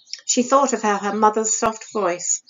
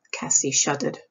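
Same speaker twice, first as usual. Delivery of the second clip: ordinary delivery, nothing marked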